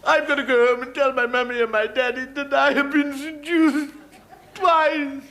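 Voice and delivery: put-on high, loud, wailing falsetto of a sobbing girl; weeping young lady. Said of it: "she begins to weep".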